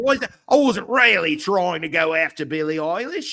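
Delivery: high-pitched comic Aussie excuse; Australian accent. Acted. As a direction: ham up an Australian accent as you explain yourself. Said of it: "that's my Australian accent".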